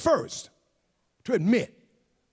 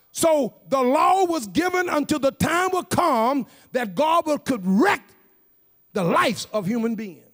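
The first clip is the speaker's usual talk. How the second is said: raised-pitch, emphatic sermon delivery; forceful preaching; 6 semitones higher, 5 dB louder than usual. Real, not acted. Preacher explains reformation to the congregation, forceful preaching. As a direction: preach it out, leaning hard on key words.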